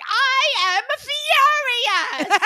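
hammed-up diva declaring rage, drawn out slowly; Meredith Marks impression. Mocking a castmate's theatrical anger, high, loud and slow. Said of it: "Meredith Marks is like".

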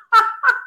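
high, loud burst of laughter; short high laugh. Real, not acted. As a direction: burst out laughing at your own story. Laughing at her candlestick story.

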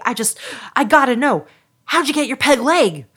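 put-on eager kid rushing out questions; excited little boy. Little boy questioning a real pirate, excited and hurried. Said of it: "so excited".

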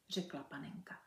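ordinary delivery, nothing marked

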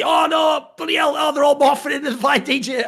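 high-pitched comic alarm; mock panic. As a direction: cry out in hammed-up horror. Acting out crowd's panic in Matrix.

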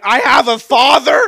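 high, loud stunned question; mock amazed. Retelling his amazement at church with a loud high gasp. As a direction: gasp it like news of a lifetime. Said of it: "I went".

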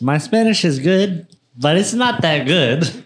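mimicking friend. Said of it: "Listen to that sentence".

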